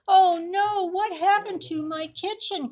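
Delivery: high, loud alarmed cry; Wolf crying out in dismay. A narrator's reading. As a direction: wail it in shock at the mess. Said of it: "cried Wolf".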